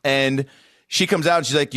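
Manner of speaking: ordinary talk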